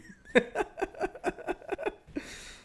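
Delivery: airy wheezing laugh without voice; breathless silent laugh. Real, not acted. Streamer wheezing at the kangaroo joke, breathless laugh.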